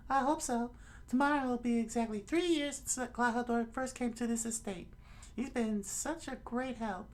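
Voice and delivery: very high, put-on girlish voice; squeaky falsetto thanks; falsetto lady. Kaya thanks her butler for the order, falsetto lady voice.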